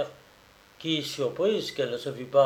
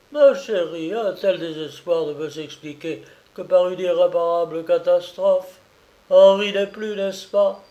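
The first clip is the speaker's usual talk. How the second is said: higher, louder, fearful questioning; anxious mother; 4 semitones higher, 5 dB louder than usual. Acted. Mother fearing her son-in-law is dead, higher and louder. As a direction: dread that he is dead. Mother fears the daughter's husband died.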